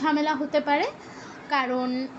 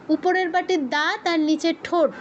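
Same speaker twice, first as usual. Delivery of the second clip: ordinary talk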